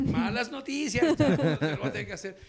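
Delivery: loud, laughing bad news; mock-regretful, laughing; laughing apology. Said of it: "Yo decía".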